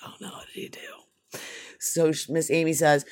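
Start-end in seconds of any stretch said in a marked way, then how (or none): 0.1-1.4 whispered nervous aside; whispered mock dread at the teacher; worried whisper. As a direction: hush it like you fear trouble. Mom called over by teacher.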